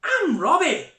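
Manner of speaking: put-on high, loud excited boy voice in Ulster Scots; ulster scots accent. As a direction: play young Robbie with an Ulster Scots lilt. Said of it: "let's read through it ... Ulster Scots words".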